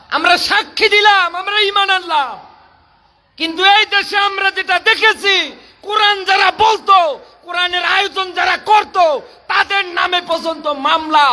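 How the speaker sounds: high-pitched, ringing rally cry; impassioned declaration. Real, not acted. Preacher declaring faith and decrying persecution, impassioned declaration. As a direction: pitch it up and proclaim it to the crowd. Declaration, then protest to the crowd.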